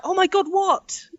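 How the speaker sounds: a terrified plea for the news; retold panic. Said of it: "And I'm like".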